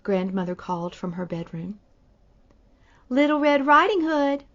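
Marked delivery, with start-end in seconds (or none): 3.1-4.4 put-on higher and louder, faking a little girl; wolf pretending to be the girl; sly wolf faking a sweet girl's voice. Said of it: "in a very high pretend voice".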